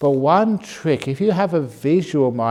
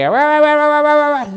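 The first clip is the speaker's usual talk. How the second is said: much higher, louder, fast mock nagging; nagging wife; 14 semitones higher, 8 dB louder than usual. Wife nagging husband at home, hammed-up shrill nagging. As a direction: rattle it off like a scolding spouse. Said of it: "it's always high pitched very fast".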